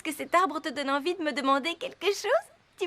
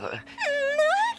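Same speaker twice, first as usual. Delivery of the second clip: eager outburst; 11 semitones higher than usual. Acted. Zoé eagerly cuts Marc off.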